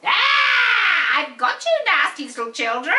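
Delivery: loud, shrieking witch cackle. Acted. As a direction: snarl it like a wicked witch. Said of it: "said the witch".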